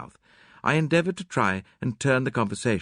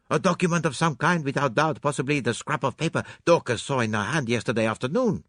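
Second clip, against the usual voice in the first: Poirot; 6 semitones higher than usual. Acted. Poirot speculates about document.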